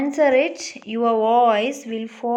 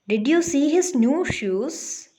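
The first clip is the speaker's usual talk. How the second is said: raised pitch, lifting at "shoes"; rising question tone; 3 semitones higher than usual. Shown as a demonstration. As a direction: read it as a question, voice going up on "shoes". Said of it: "it's rising tone".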